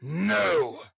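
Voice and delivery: forceful shouted retort; cried out. Acted. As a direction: cry it out as an angry ruffian. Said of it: "cried Mister Sikes".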